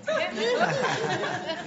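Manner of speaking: high, slow laughing aside. Real, not acted. Preacher taking back his Jamaican joke, laughing. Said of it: "he must have been Jamaican".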